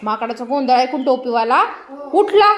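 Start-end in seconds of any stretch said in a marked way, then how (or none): 2.1-2.5 sudden wake-up. Acted. Story climax, hat seller wakes.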